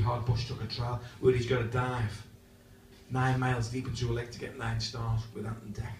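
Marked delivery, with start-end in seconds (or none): none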